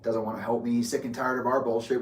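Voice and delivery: ordinary talk, nothing marked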